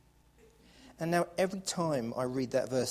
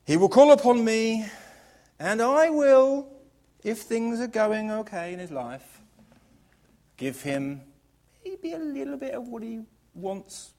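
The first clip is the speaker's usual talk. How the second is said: mock grudging God; 7 semitones higher, 5 dB louder than usual.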